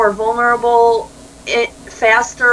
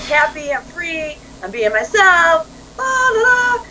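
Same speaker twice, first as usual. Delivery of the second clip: high-pitched, gleeful kid imitation ending in sing-song; carefree toddler; 7 semitones higher than usual. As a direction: mimic a happy little kid running free. Acting out carefree naked toddlers.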